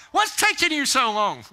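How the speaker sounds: high, loud mock exasperation; acted impatient holler; impatient demand. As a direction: call out at a dawdler. Acted impatience at slow pedestrian.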